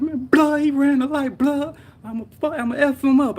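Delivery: high, heated yelling; retold angry cussing. Injured driver complaining to a bystander, mad high-pitched yell. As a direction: rant furiously as in the retold moment. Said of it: "cussing, like".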